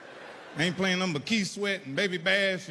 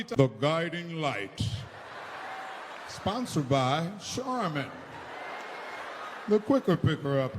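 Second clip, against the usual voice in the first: slow, drawn-out soap opera sponsor voice; lightly spoofing an old daytime TV announcer; mock TV announcer; 3 semitones lower, slower than usual. Comic recalling daytime TV, announcer read, slow.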